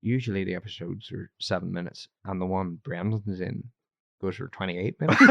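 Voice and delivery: low, soft Irish-accent imitation; mixed-up Irish accent. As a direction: deliver it deadpan in a muddled Irish brogue. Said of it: "amalgam of all different kinds of counties".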